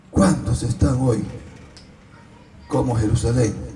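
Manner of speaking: ordinary talk, nothing marked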